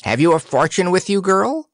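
high, quick demand; crowing weathercock. A narrator's reading. Weathercock asking Dorothy about a fortune, quick crow. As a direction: fire off the question like a crowing bird. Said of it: "crowed the weathercock triumphantly".

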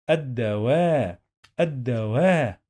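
الدواء with a stretched "waa"; long vowel alef. Shown as a demonstration. Teacher showing long vowel ألف, stretched "waa" in الدواء. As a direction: draw out the "waa" closing الدواء, ad-da-waaa. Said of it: "Long vowel ألف".

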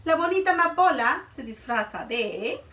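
normal talking voice

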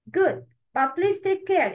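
normal talking voice

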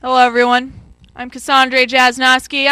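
loud perky reporter intro; mock field reporter. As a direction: greet the viewers like a live reporter.

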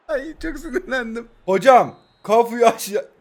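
usual speaking voice

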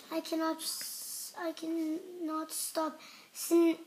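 ordinary delivery

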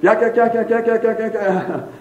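high-pitched imitation of endless gossip; mock gossiping ladies. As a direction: mimic women gabbing on and on, pitched high. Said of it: "I heard about ninety-eight percent of yak".

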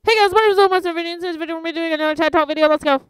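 loud, high, rushed youtuber intro; hyped intro. Shown as a demonstration. Youtuber trying out the pace lesson, excited and fast. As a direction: blast through it at top energy.

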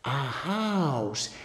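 soft, stretched "house"; hushed, drawn-out picture-book reveal; storyteller's wonder. Shown as a demonstration. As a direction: softly marvel at the picture for a child. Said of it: "Y haces".

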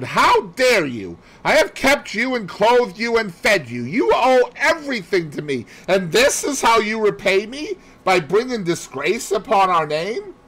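wife scolding husband with loud outrage; furious mistress. Retold or imitated. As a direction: lash out at him in disgust.

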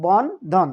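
Bandhan slowly broken into two beats; syllable by syllable. Shown as a demonstration. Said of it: "দুইবারে বললাম".

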